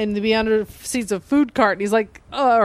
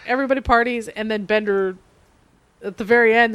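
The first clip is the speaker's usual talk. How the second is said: ordinary talk, nothing marked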